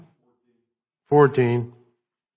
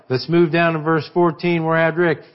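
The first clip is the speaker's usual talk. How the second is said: ordinary talk, nothing marked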